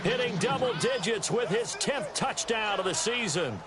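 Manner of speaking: football announcer selling a big score; excited play-by-play. Acted. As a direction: ride the play into the end zone with drawn-out words. Calls a touchdown play.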